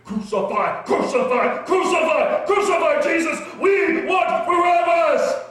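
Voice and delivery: very high, loud mob shouting, building; crowd screaming. Acted. Crowd drowns out Pilate, frenzied high screaming. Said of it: "the crowd just screamed".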